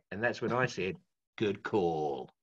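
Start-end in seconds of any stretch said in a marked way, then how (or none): 1.4-2.3 low, deep ad-tagline read; ad voiceover. Retold or imitated. Telling friends about the Foster's ad, low tagline voice. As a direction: land the tagline low and easy. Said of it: "the voice of Foster's".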